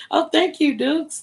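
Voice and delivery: high, loud delighted thank you; excited thanks. Real, not acted. Thanking a viewer for loving her look, delighted. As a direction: squeal a grateful thanks.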